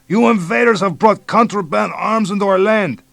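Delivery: put-on high, loud bellow with Mexican accent; shouting. A narrator's reading. Police chief bellowing at American invaders, loud shout. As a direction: bellow it from the jungle.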